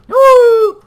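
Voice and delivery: very high, loud wail; screamed no. Real, not acted. Player reacts to the fight, screamed no. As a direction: let out a sudden howl. Reacts after killing, sudden alarm.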